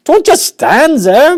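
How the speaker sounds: very high, loud, slow scream; a wife screaming at her husband. Acted. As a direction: scream at him to act. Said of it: "Missus Gloop screamed".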